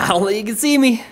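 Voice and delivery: high mocking replay of himself; mock his own hopeful line. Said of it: "I was like".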